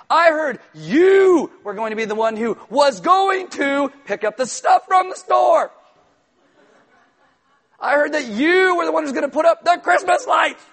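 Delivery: hammed-up furious email read aloud; all caps. Shown as a demonstration. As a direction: bellow an exaggerated scolding, word by word. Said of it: "ah, all caps, exclamation point".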